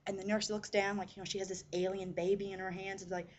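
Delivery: plain reading voice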